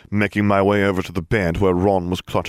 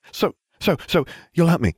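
eager pleading; 10 semitones higher than usual. A narrator's reading. Lovesick Ron begging for help.

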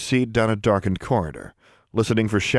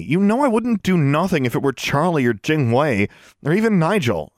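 higher, urgent appeal; earnest insistence; 6 semitones higher than usual. A narrator's reading. Girl justifying a rescue to her roommate, earnest insistence. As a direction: press the point on a friend.

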